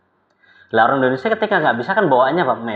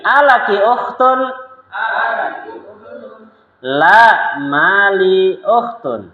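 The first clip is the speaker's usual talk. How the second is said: slower, stretched repeat of the drill; 7 semitones higher, 4 dB louder, much slower than usual. Shown as a demonstration. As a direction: repeat it very slowly for the class. (ulangi dengan sangat pelan)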